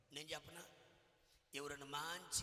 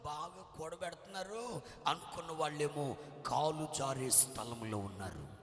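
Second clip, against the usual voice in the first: loud preaching, slowing at the end; full-force preaching; 3 semitones lower, 8 dB louder, slower than usual. Real, not acted. Preacher's climax to the congregation, loud forceful preaching. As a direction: drive the point home with force.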